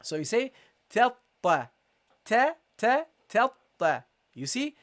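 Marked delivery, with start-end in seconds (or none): 0.9-4.0 ta ta repeated, doubled t; teacher drilling the shadda; drilling syllables. Shown as a demonstration. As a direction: tap out ta ta. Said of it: "pronounce the second ta".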